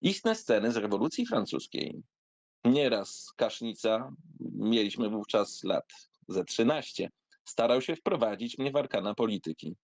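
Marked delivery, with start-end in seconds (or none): none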